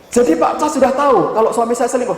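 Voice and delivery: high, indignant question; retold incredulous outburst; shocked accusation. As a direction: imitate a wife finding out you knew. Wife confronts counselor who knew.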